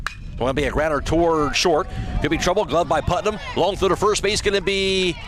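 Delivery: rising pitch as the grounder heads to short; excited call. Real, not acted. Announcer calling a tight play at first for radio listeners, rising excitement. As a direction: call the throw to first with building urgency.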